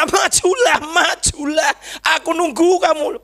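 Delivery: high-pitched pleading call; retold shout. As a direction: re-enact calling them to come forward. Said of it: "Kan saya udah teriak".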